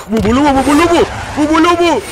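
very high, loud silly radio babble; mock radio call. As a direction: squeal mock code words into the radio. Mock radio call during airstrike. (falsa llamada de radio, chillona y aguda)

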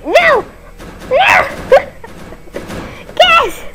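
very high cries with a breathless laugh; shrieking panic. Real, not acted. Player seeing the gas taken, shrieking panic. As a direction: squeal in horror.

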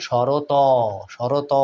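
শরত said as sho-ro-to, with a drawn-out open o at the end; sounded final vowel. Shown as a demonstration. Teacher showing students the wrong form, final o sounded. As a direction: add a long o after the t of শরত. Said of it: "উচ্চারিত হতো তাহলে আমরা বলতাম".

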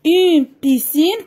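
"piscine" said slowly with a clear P; slow clear dictation. Shown as a demonstration. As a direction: stretch "piscine" so the P stands out. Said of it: "كنسمع بي". (نطق "piscine" ببطء مع إبراز حرف بي)

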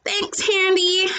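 put-on mildly sniffling boy; sniffly thanks. A narrator's reading. Hurt boy thanking his friend, mild sniffles. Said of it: "threw in slowly between sniffs".

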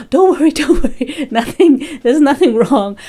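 tearful laugh telling the delivery man not to worry; laughing reassurance. Retold or imitated. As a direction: reassure him while laughing at yourself.

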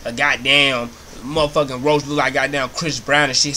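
ordinary talk, nothing marked

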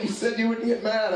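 high-pitched childlike complaint, played for laughs; mock wounded protest. Child protesting to dad after a smack, high mock whine. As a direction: imitate a kid protesting a broken promise.

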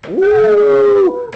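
high, drawn-out mock howl; spooky wolf call for the kids' play. Wolf howling in the woods.

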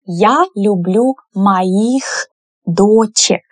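slow, careful model sentence. Shown as a demonstration. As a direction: say it slowly for learners to copy. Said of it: "teacher models sentence".

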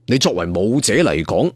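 ordinary delivery, nothing marked

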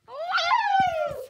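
loud high woo; squeaky cheer. Acted. Ship takes off, loud squeaky whoop. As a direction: whoop in a tiny voice.

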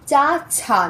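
ordinary talk

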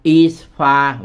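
drawn-out, slow model of is far; slow careful reading. Shown as a demonstration. Teacher reading slowly to Vietnamese students. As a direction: read it slowly for learners. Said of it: "ở xa".

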